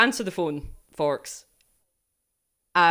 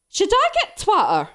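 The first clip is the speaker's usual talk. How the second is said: higher mock-mum voice asking innocently; mum impression; 12 semitones higher, 5 dB louder than usual. Streamer imitates her mum asking about Twitter, higher mock voice. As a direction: play the clueless mum, pitched up. Daughter voicing her mum's question.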